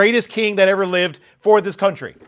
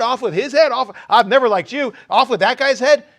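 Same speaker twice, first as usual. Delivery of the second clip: loud, high, fast indignant rant; David tearing into the fakes; mock outrage; 6 semitones higher, 5 dB louder than usual. David could have shouted at the phony tribes, loud and furious. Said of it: "what he could have said".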